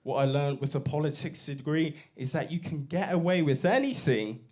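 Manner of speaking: ordinary talk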